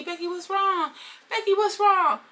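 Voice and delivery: high, urgent calling after her; concerned friend. Retold or imitated. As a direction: chase her with worried questions. Acted concerned friend running over.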